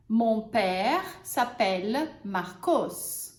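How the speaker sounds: slow, clear example; teacher models the sentence. Shown as a demonstration. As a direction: model it slowly. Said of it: "vamos usar os exemplos agora".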